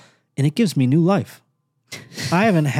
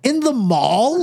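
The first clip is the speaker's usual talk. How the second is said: high, drawn-out mock disbelief; incredulous; 12 semitones higher, much slower than usual. Reacting to homemade T-shirts sold there, incredulous. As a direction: question it as if scandalised, slowly.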